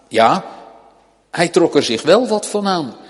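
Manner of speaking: ordinary talk, nothing marked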